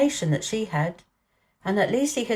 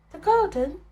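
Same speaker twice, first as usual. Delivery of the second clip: put-on high, curious little girl; a bright girl teasing Colin gently; Mary, girl's voice; 11 semitones higher than usual. A narrator's reading. Mary asks Colin what he's thinking, high girl's voice.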